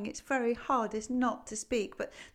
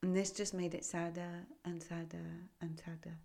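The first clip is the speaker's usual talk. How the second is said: whispering; 2 semitones lower, 7 dB quieter than usual. A narrator's reading. Sad narration.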